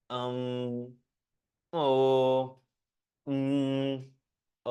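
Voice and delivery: drawn-out hesitant um and uh; filler sounds. Shown as a demonstration. Teacher demonstrating filler words to the class, drawn-out ums. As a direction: hum the fillers like a nervous speaker. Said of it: "हम बोलते वक्त ऐसे करते हैं ना".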